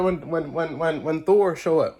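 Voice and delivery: normal talking voice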